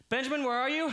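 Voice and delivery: high, loud desperate shout; frantic call. Acted. Father shouting high and loud for his missing son. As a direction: yell for the missing boy.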